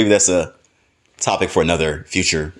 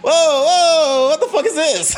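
much higher, half-laughing freakout; startled yelp as it dawns on him; panicked alarm. Retold or imitated. Retelling his panic as the husbands undress, high alarmed squeal. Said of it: "I'm thinking in my head".

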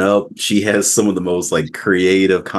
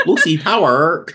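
"Lucy Power" with a high, hammed-up American r; mocking American accent; 9 semitones higher than usual. Host teasing guest, mock American accent. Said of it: "You're like".

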